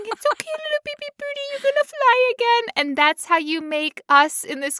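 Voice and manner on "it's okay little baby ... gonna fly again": put-on high baby-talk coo; cooing to a baby bird. Amy comforting a little baby birdie with a broken wing, high coo. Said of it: "and you're going".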